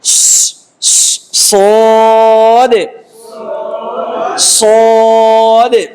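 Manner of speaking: hissed স, then সদে with a heavy, long vowel; thick, sustained sod. Shown as a demonstration. Teacher showing flowing breath, thick drawn-out সদে. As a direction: let the air run on স and stretch a thick সদে. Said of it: "উচ্চারণটা মোটা হয়".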